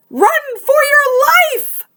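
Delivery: high, loud rabbit yelling to flee; panicked shout. Acted. Rabbit tells all animals to flee, loud panicked shout. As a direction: scream the warning, all panic. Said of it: "shouted a rabbit".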